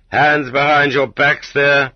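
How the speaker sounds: guard's command. Retold or imitated. Guard ordering arriving prisoners, slow loud command. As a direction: call it out like a camp guard.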